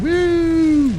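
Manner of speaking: high, loud whoop; victory yell; excited cheer. Real, not acted. Cheering a kill in battle.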